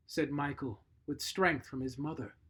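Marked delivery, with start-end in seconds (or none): none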